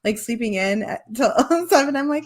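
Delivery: normal talking voice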